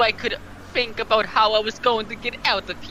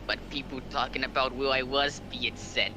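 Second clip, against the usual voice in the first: hushed narrator; 6 semitones lower, 10 dB quieter than usual. Acted. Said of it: "do the point of view".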